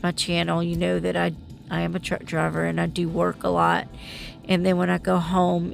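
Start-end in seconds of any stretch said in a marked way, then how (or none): none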